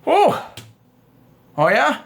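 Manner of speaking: teasing high oh, then a smug oh yeah; cocky agreement. Shown as a demonstration. Man answering his venting girlfriend, cocky and teasing. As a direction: agree like you don't buy it. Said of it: "I'm agreeing in a cocky way".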